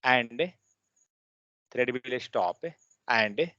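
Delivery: slow dictation with phrases repeated; dictating. Shown as a demonstration. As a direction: pause for students to write. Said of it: "Write down synchronous".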